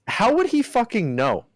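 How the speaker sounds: high, exasperated question; disbelieving outburst; incredulous. Real, not acted. Incredulous rant about Jake Paul.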